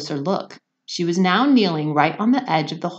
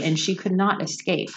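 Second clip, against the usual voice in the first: ordinary delivery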